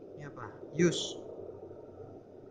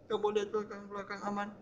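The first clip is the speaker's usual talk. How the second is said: ordinary talk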